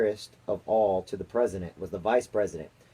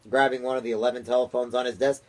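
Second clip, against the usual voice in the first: usual speaking voice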